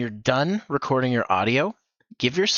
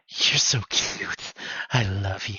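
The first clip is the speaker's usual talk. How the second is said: very slow, half-whispered; a little crazy; 2 semitones lower, slower than usual. Pretend. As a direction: breathe it out obsessively. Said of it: "a little crazy hair".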